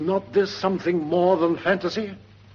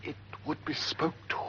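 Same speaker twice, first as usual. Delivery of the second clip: whispered, much softer; hush, it wants a word; 9 dB quieter than usual. Acted. Guard whispering that the ghost wants speech.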